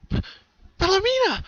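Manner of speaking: high, loud startled call; stammered loud shout of a name. A narrator's reading. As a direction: cry her sister's name. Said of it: "she called out".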